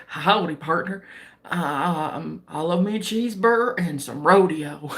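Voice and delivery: low-pitched imitation of a fake Southern American; mock Southern accent. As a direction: imitate a Brit attempting Southern cowboy talk. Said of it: "like, the Southern accent".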